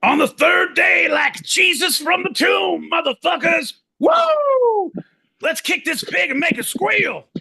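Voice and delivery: high, loud triumphant holler; whooping, over-the-top battle cry; hyped-up shouting. Pretend. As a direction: announce a comeback at full shout. Hyped announcement of his return.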